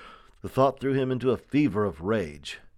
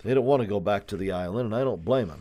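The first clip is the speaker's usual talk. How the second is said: ordinary delivery, nothing marked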